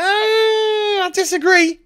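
high, slow, wailing cry of I disagree; mock wailing shout. To the imagined critic, a high wailing mock cry. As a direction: ham up a wounded howl.

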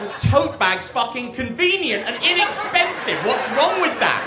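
loud, high-pitched mock outrage; indignant protest. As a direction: protest it loudly, voice pushed up high. Mock protest at audience's teasing.

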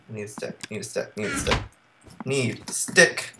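very soft, low repeated muttering; muttered to self. Real, not acted. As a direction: mutter it under your breath while searching. Mutters to self while searching.

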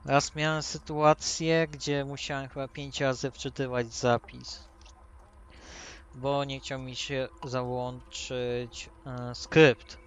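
ordinary talk

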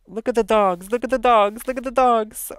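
delighted shout at the dogs; loud excited. Real, not acted. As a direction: build excitement with each repeat. Excited about werewolf dogs.

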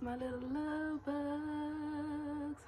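high, slow, loud showman welcome; hyped greeting. Acted. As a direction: stretch every word like a big hype intro. Hyped vlog greeting to viewers.

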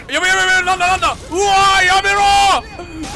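loud, high, frantic screams; panicked yelling. Real, not acted. Player screaming at a sudden attacker, high and loud panic. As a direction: yelp and shout in real panic at the attacker.

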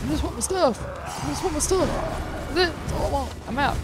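high, loud desperate whine; frantic pleading. Real, not acted. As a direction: plead to be left alone. Begging enemies, wants stuff back.

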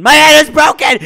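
high, very loud panicked yell; pained scream. Acted. Injured puncher realising his hand is broken, pained scream. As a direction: scream it out in agony. Same words, first low then screamed.

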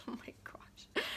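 laughing whisper. Real, not acted. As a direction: breathe it out with a giggle. Laughing whisper at old story.

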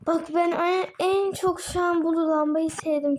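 high, slow, delighted; excited. Real, not acted. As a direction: gush over the lamp. Excited about the lamp.

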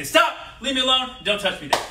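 high, loud firm shout; loud demo. Shown as a demonstration. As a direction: model it for the kids to copy.

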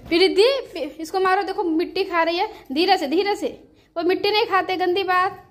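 urgent scolding. Real, not acted. Urging sister, scolding toddler.

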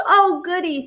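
high, gleeful exclamation; delighted. A narrator's reading. Bear learns what to buy the moon, delighted.

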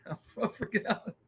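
laughing admission. Real, not acted. Laughing as he admits panic.

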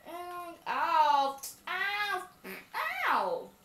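loud cries of pain; mock pain cries. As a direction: yelp ow over and over. Hurt after truck hit.

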